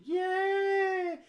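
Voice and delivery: very high mock crowd "yay"; crowd cheer. Imitating the E3 crowd cheering at the reveal. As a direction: squeal like a cheering fan.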